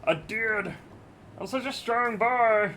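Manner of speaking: high, slow goofy strongman boast; silly clown. Pretend.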